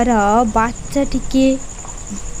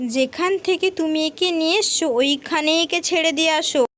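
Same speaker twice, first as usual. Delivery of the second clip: higher, softer wife's voice, questioning; suspicious wife; 5 semitones higher, 5 dB quieter than usual. Acted. Wife asks Ramprasad where the child came from, suspicious. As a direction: ask him where he found this child.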